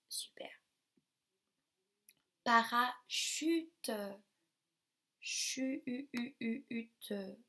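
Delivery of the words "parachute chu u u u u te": "Parachute" said very slowly, then chu and its vowel held and repeated, fading; sounding out syllables. Shown as a demonstration. Teacher to class, segmenting parachute into its sounds. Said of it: "Là".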